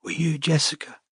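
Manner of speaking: put-on high, soft, breathy girl's whisper; faint scared voice on the phone; frightened whisper. A narrator's reading. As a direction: barely get the words out, afraid. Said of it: "A voice whispered in Robin's ear".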